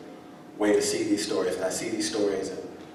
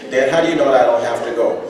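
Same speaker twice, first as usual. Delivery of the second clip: son laughing back; 5 semitones higher, 12 dB louder, faster than usual. Retold or imitated.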